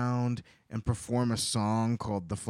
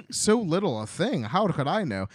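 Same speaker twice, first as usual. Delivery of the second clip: defensive; 6 semitones higher, 6 dB louder than usual. Retold or imitated. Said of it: "he was like".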